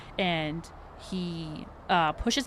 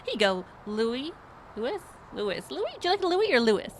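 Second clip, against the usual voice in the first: very high, fast, gushing perky voice; bubbly HR lady; 10 semitones higher than usual. Acted. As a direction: chirp it out like an overeager hostess. GM keeps playing Becky.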